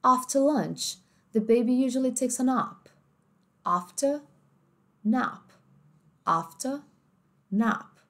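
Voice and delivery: British read with "After" as ahfta; British accent. Shown as a demonstration. Teacher contrasting British after lunch for students. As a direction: say "After" with a broad a and no r. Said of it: "No inglês mais britânico".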